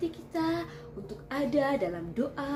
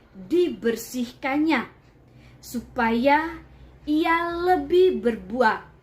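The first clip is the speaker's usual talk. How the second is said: scripture reading; 6 dB louder than usual. Acted. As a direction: proclaim the verses to the room. Reads the Bible passage aloud.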